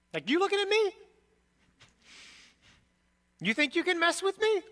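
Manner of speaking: a hammed-up come-at-me jab; mock tough guy. Mock tough-guy challenge to opponent.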